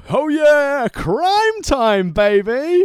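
high, loud, stretched-out wrestler catchphrase; drawn-out, high-pitched hype call; hyped entrance shout. Acted. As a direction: belt it out like a wrestling hype man. Mimicking wrestling team's entrance catchphrase.

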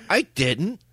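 "I didn't." pitched high with mock innocence; flat denial. Friend caught cheating, denying it to his girlfriend, high innocent denial. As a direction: deny it straight to her face. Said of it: "Like that".

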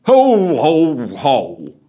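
booming ho-ho-ho, starting high and stepping down; big, loud Santa chortle; Father Christmas laugh. Acted. Drunken Santa answering Marley's hail, hearty ho-ho-ho. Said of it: "replied Father Christmas".

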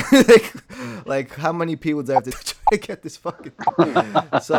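words breaking up with real laughter; laughing while talking. Real, not acted. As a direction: laugh through the line at the absurdity. Laughing at his own struggle.

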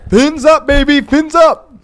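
high, chanting fan cheer; rally the team. Real, not acted. Fan cheering for the Dolphins, high chant.

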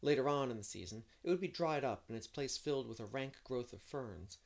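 measured audiobook storytelling; story narration. A narrator's reading. Narrator setting the scene of a spring picnic day, storytelling. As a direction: read the scene aloud, shaping each phrase.

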